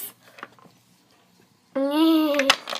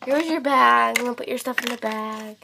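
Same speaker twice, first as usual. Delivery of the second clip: usual speaking voice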